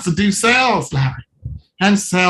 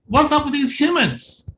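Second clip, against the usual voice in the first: usual speaking voice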